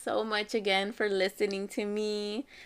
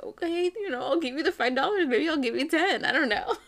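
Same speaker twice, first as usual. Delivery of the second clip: high-pitched giving in, building into laughter; amused mock agreement; 9 semitones higher, 4 dB louder than usual. Mom mocks herself agreeing to pay her son, breaking into laughter. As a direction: play the gullible parent, cracking up by the end.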